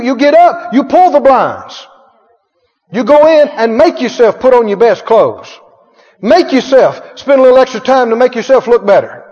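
normal talking voice

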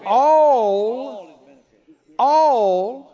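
"All" shouted out loud twice; stressing all. Acted. Preacher drives home "all" to congregation, loud. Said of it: "his benefits".

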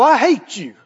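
lightly voiced hateful adult; a hint of an adult meaning it; grown-up's hatred. Preacher contrasts an adult with a child, lightly voiced hateful grown-up. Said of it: "A twenty-one-year-old look at you and go".

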